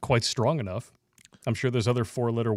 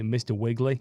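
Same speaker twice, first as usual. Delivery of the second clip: put-on Boston accent. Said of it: "Like from Boston?".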